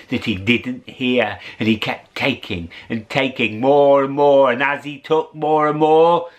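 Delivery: plain reading voice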